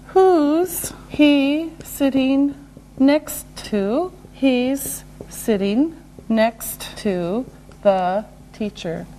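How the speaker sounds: word by word with gaps; slow and deliberate. Shown as a demonstration. Teacher modelling who's for learners, slow and separated. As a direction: take each word slowly and separately.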